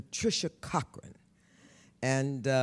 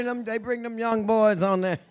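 ordinary delivery

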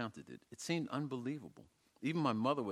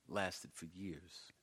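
hushed whispered confession; 3 semitones lower, 10 dB quieter, much slower than usual. A narrator's reading. Narrator whispering his childhood jealousy. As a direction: whisper it like a secret.

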